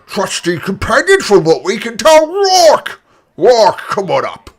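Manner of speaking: put-on booming old orc addressing the crowd; elderly orc announcer.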